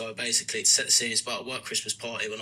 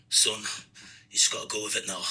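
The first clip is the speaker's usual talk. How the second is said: badly faked Northern Irish dad; attempted Northern Irish accent; 3 semitones lower than usual. Dad advising his son, rough Northern Irish accent. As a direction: lightly put on a poor Northern Irish accent. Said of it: "he's Northern Irish".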